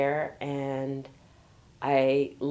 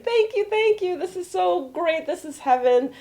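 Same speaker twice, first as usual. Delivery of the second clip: high-pitched, grateful gush; gushing delight; 13 semitones higher, 5 dB louder than usual. Retold or imitated. Traveler thrilled by the five-star resort, gushing delight.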